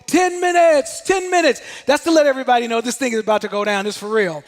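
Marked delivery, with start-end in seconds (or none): 0.1-1.6 high-pitched echoed call; troops repeating the call. Retold or imitated. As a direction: pass the warning along, raised and chanting. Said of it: "you rock as you repeat it".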